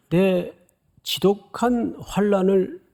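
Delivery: ordinary talk, nothing marked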